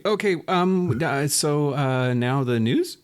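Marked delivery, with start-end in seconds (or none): 2.1-3.0 "now the news" slow, with a questioning lift on "news"; questioning tune. Real, not acted. Host introducing the segment to co-host, "now the news" as a question. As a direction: turn "now the news" into an unsure question, rising on "news". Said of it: "less with a question mark".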